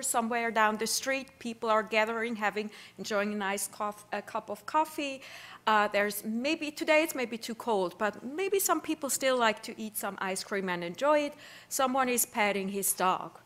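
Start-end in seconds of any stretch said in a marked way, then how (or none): none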